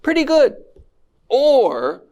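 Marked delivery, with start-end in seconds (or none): 0.0-0.6 "Pretty good" high and bright; cheerful casual answer. Shown as a demonstration. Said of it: "we really often hear".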